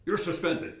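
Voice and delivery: retelling the boss's joke at the sports office door, mock stern and loud. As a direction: bark it like a boss pretending to be furious. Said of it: "and say".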